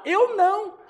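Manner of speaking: imitating blunt answer. Husband answers the pastor's question, mock blunt. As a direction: fire back an unthinking mock refusal. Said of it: "Ele disse na lata".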